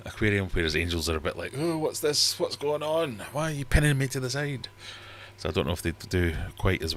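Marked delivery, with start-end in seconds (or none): 1.6-4.7 much higher, bewildered angelfish; puzzled fish voice. Retold or imitated. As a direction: play the confused angelfish.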